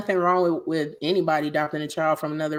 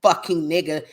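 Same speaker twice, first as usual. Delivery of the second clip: ordinary talk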